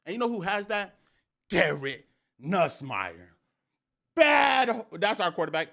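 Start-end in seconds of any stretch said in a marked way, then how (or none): 4.2-4.8 loud, high, punched single word; hyped exclamation. Real, not acted. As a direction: blast it out in admiration. Hyping his quarterback.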